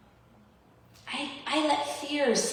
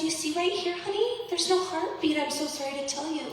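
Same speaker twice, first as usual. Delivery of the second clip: higher, syrupy nurse voice easing bad news; mock sweet nurse; 7 semitones higher than usual. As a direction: nurse calling her honey, sugary and much higher. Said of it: "And she says".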